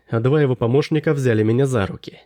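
plain reading voice

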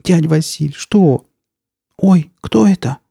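put-on high, anxious girl's cry; holding back tears. A narrator's reading. Nastya to injured uncle, then spotting a creature, alarmed cry. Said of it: "сдерживая слёзы, крикнула Настя".

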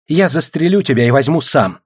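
high, agitated threat; hysterical threat. A narrator's reading. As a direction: threaten while losing control. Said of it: "истерически воскликнул советник".

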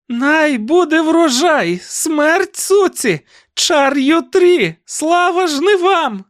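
very high, slow, loud gloating chant; triumphant crazed aunt. A narrator's reading. Aunt dancing a jig beside the cart, gloating screech. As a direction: crow each cry in mad triumph. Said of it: "тріумфально витанцьовуючи джигу".

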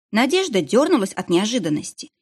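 ordinary delivery

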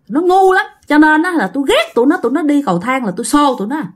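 high, fast scornful scolding; scolding ghost. Retold or imitated. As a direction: rattle off her grudge against them. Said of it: "Bà này bà chửi ngu". (hồn ma mắng nhanh, giọng cao)

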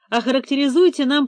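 plain reading voice

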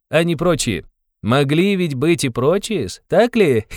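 high, animated teasing with little giggles; sing-song mockery, breaking into tittering; merry mocking interrogator. A narrator's reading. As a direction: needle him gleefully in a high voice. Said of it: "сохраняя самый весёлый и насмешливый вид".

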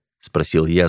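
ordinary delivery

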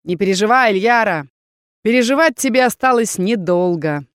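loud mock comfort, rising in pitch; gloating villain leader. Rebel leader threatening the heroine, triumphant. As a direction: console falsely, then exult.

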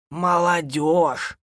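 high, long-drawn exclamation; scoffing granny. A narrator's reading. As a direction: draw the word out disdainfully. (бабушка протяжно, с укором)

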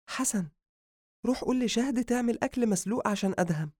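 high-pitched female character; sister's female voice. Acted. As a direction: send your brother off in a high female voice. Narrator voices sister giving errand.

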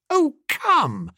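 high-pitched, impatient objection; exasperated protest. Acted. Bertie protests at Jeeves's refusal.

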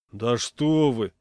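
slow, loud offended protest. A narrator's reading. Dog's owner accused of reeking of kerosene, offended. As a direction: draw it out, affronted. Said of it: "обиделся я".

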